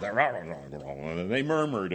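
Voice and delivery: low, drawn-out crowd grumble; imitated grumbling. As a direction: grumble and murmur under the words, very slowly. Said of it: "They grumbled".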